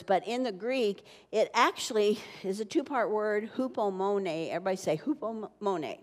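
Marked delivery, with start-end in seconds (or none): none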